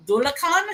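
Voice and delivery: Dulacan as DOO-la-kan, rising; tentative Gaelic pronunciation. Shown as a demonstration. Listing possible names of the creature, Dulacan tried questioningly. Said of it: "gonna be butchering the pronunciation".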